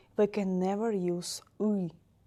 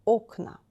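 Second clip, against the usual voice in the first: Окна with a louder, stressed first о; 3 semitones higher, 6 dB louder than usual. Shown as a demonstration. Teacher repeats the plural, first-vowel stress. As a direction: say OKna, falling off after the О. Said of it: "The first vowel is stressed".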